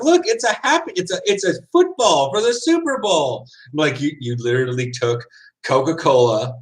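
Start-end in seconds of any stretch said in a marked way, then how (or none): 0.0-3.4 high, bright-eyed mock salesman; mock ad hype. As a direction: sell it like a cheesy commercial. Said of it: "they're like".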